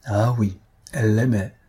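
usual speaking voice

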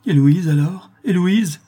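high, loud frantic questioning; distraught father crying out; half-crazed outcry. A narrator's reading. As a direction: demand it desperately, almost out of your mind. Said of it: "s'écria Morel, presque égaré".

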